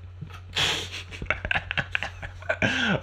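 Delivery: high, quiet wheezy laugh. Real, not acted. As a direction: laugh softly at a corny joke. Laughing at own baked bean joke.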